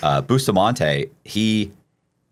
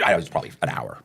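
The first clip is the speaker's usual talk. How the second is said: ordinary delivery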